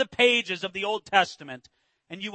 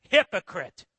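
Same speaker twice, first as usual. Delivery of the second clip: forceful, raised-voice accusation; indignant rebuke; 2 semitones higher, 4 dB louder than usual. Acted. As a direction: confront a hypocrite square in the eye. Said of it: "a little bit indignant right back".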